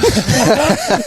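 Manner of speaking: high, loud burst of laughter; big laugh. Real, not acted. Laughing loud at his friend's joke. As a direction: cracks up loudly at the savage remark.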